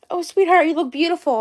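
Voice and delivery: put-on quoting sweet boyfriend. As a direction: lightly imitate a doting partner. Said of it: "David could say".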